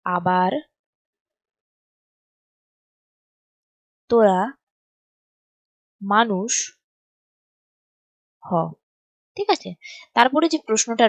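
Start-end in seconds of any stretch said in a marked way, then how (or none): 0.0-8.5 one word at a time with long pauses; spaced-out writing-along delivery; slow dictation. Shown as a demonstration.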